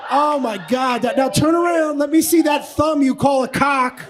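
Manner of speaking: loud, gloating sleazy come-on; leering come-on. Pretend. Comic mocking a lover's body, loud sleazy leer.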